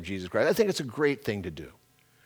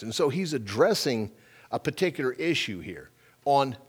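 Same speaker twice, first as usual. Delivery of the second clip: usual speaking voice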